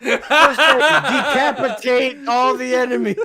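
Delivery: high, loud mock through laughter; sarcastic mock lesson while laughing. Real, not acted. Joking about the healer beheading foes, laughing loud. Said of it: "mocking the healer scene".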